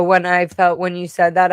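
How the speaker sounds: usual speaking voice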